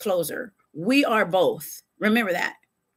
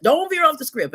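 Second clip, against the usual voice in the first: higher, mock-insistent repeat; quoted rule again; 5 semitones higher than usual. Repeating the boss's rule, higher and mock-nagging. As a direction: push the repeat up like a nag. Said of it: "they always tell you".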